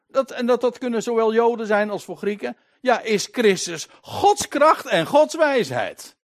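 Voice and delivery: high, forceful scripture proclamation; emphatic preaching. Acted. Preacher proclaiming Christ as God's power, emphatic preaching. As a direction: drive the verse home.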